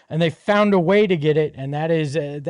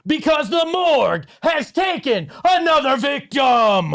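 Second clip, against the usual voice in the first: dramatic shouting; 8 semitones higher, slower than usual. Acted. Dramatic morgue send-off for opponent.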